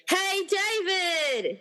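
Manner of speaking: long drawn-out call across the room. Acted. [name] calls out to David across the army, drawn-out call. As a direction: call his name slowly across the crowd.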